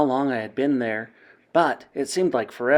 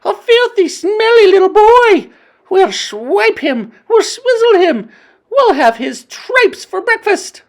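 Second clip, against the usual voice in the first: high, loud, hungry witch voices; ravenous witch crowd; 11 dB louder than usual. A narrator's reading. Witches threatening the boy, hungry vicious shouting. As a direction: savour the threat greedily.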